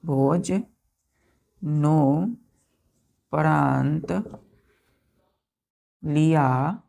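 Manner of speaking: the word stretched very slowly, syllable by syllable; slow dictation. Shown as a demonstration. Teacher dictating the word slowly to write. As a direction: draw the word out for copying. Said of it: "अच्छे से लिखेंगे".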